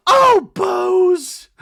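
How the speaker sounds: hammed-up Dorit gushing; mock Dorit. Host voicing Dorit fake-reluctant, high. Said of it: "And Dorit's like".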